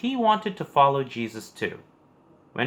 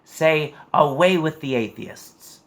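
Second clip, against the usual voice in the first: louder, higher urgent plea; pleading proconsul; 4 semitones higher, 5 dB louder than usual. A narrator's reading. Proconsul urging Polycarp to denounce Christians, louder urgent plea. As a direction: push the plea out, louder and pressing. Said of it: "the proconsul begged".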